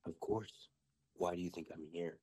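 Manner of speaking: lightly imitated child witch, low and deadpan; daughter in character. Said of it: "she's talking just like this".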